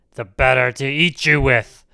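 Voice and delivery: loud, menacing wolf roar. A narrator's reading. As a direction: roar it out, ready to pounce. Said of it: "roared the wolf".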